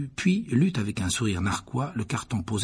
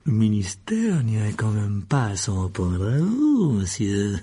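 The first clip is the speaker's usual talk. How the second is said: vieux marchand mielleux; 4 dB louder, slower than usual. Acted. Barjot joué par le narrateur.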